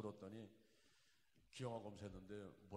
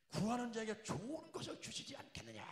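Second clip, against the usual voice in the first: preacher's shout; 14 semitones higher, 11 dB louder than usual. Acted. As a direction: thunder the promise at the congregation. Repeats the verse that struck him. (높고 크게 외치는 설교 절정)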